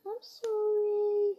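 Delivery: high slow apology; dog's voice. Pretend. Girl voicing her dog apologising, high and slow.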